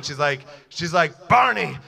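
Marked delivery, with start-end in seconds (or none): 1.3-1.8 louder mother screaming the name. Retold or imitated. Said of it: "she starts screaming".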